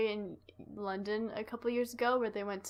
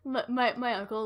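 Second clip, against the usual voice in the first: normal talking voice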